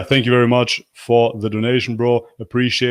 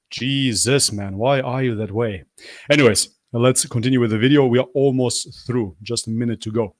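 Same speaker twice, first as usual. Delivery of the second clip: ordinary talk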